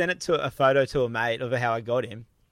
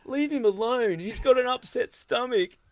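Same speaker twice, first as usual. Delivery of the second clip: higher-pitched mock scolding; high, defensive girl impression; imitated protective girl; 9 semitones higher than usual. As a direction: mimic a girl standing up for him. Girl defends Jad in retold story.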